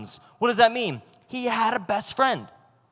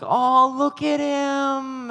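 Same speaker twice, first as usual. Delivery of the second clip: high, drawn-out mock coo; hammed-up high adoring voice; cooing admirers; 6 semitones higher, much slower than usual. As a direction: gush over a cute dog, slowly. Everyone cooing over the dog.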